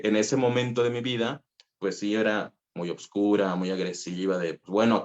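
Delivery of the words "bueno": ordinary delivery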